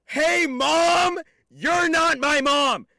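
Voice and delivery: high, loud drawn-out yell; hammed-up shout; mock outburst. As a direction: holler it like an indignant kid. Mock outburst answering a chatter.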